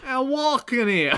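high, loud, drawn-out New York holler; ludicrous New York accent. Acted. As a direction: yell it like a parody New Yorker. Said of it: "ludicrous New York accents".

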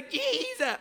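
mock pubescent voice crack; high squeak as the voice breaks. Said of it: "I sound like I'm a pubescent boy".

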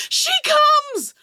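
crazed, high-pitched loud scream; screaming madman. Acted. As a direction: scream it like a raving doomsayer. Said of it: "starts screaming at the top of his lungs".

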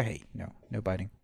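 soft firm no to the dog; quiet scolding. Real, not acted. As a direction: hush the dog, low and firm. Puppy biting chair.

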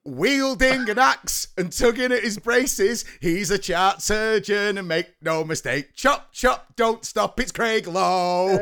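loud hyped announcer intro; announcer hype. Acted. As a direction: bellow it like a ring announcer.

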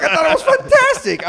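high, loud delighted gush; excited gush. Retold or imitated. Recalling first seeing Vinny, gushing loudly. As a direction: gush with glee about the show. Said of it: "I was like".

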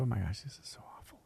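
breathy crying whisper, quick; tearful whisper. Retold or imitated. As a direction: whisper it through tears. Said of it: "and she's crying".

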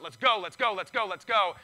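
loud, driving repeated chant; rally the troops, punching each GO; urgent rallying cry. Acted. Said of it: "apostolic churches are all about".

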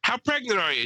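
high mock old lady voice; old woman at bingo questioning the pregnant girlfriend; old lady asking. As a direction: pitch it up like the curious neighbour. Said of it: "looks at her and goes".

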